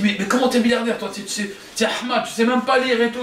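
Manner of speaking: high-pitched, stammering disbelief; incredulous scoffing. Acted. Wife doubting her illiterate husband's riches, incredulous scoffing.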